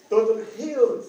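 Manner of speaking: "Totally healed" called out high and loud; excited exclamation. Real, not acted. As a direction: announce the healing like a victory. Celebrating the healing.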